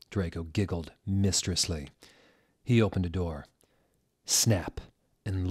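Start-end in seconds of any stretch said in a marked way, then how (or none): none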